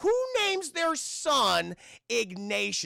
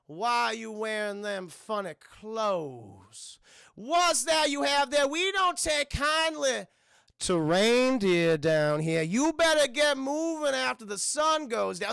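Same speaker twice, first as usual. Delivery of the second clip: slow, drawn-out mock Southern drawl; hostile Southern townsfolk; 3 semitones higher, 4 dB louder, slower than usual. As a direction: warn off strangers in a hammed-up Southern accent. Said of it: "They're instantly saying".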